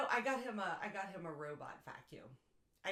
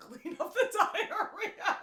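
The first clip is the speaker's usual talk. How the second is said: laughing; 14 semitones higher, 11 dB louder, slower than usual. Real, not acted. Laughing while speaking.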